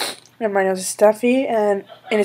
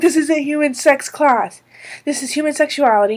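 higher, louder retold protest; raised-voice mock outburst of exasperation; exasperated retort; 6 semitones higher, 5 dB louder than usual. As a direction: act out fed-up disbelief at the class. Acts out exasperation at classmates' questions.

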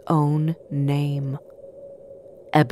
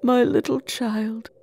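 tearful grief; 4 semitones higher than usual. A narrator's reading. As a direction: mourn gently, a touch tearful. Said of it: "He broke down in tears".

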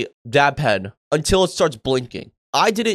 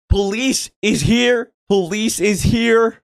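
retold scream; 5 semitones higher, slower than usual. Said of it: "someone screaming".

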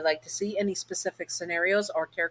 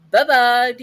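much louder, higher sing-song goodbye; loud sign-off; 7 semitones higher, 12 dB louder than usual. Real, not acted. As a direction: belt out a cheery farewell. Vlog sign-off.

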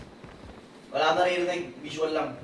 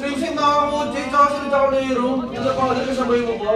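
excited callout; 7 semitones higher, 5 dB louder than usual. Real, not acted. Urgent callouts mid-fight.

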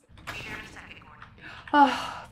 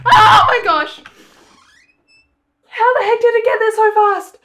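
loud shocked high exclaim; startled outburst; scared horror game streamer; 7 semitones higher, 5 dB louder than usual. Real, not acted. Monster suddenly close.

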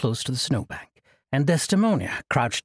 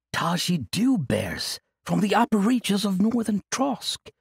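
in awe; 7 semitones higher than usual. Acted. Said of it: "Orin said in awe".

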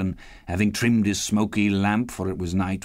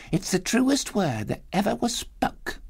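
Mrs Dilber; 11 semitones higher than usual. A narrator's reading. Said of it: "said Missus Dilber".

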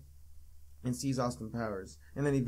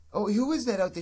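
mock calling out; 6 semitones higher, 6 dB louder than usual. Imitating character calling out.

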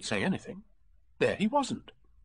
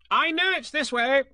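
high, loud, cocksure Rabbit; insistent; 6 dB louder than usual. A narrator's reading. Rabbit sure of the way home, loudly insisting. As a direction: insist at high pitch and volume.

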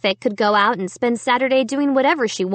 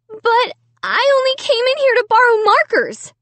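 trembling voice; 5 dB louder than usual. A narrator's reading. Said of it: "in a trembling voice".

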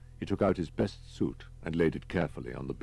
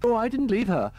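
very high, loud protest; hysterical reply; 7 dB louder than usual. A narrator's reading. Distraught man assures Holman about his wife, hysterical. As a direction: answer in a high, overwrought voice.

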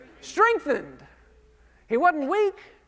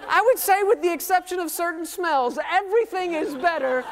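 higher, louder preaching call; rally the congregation, full voice; 7 semitones higher, 4 dB louder than usual. Real, not acted. Pastor urging the crowd to answer, loud and high.

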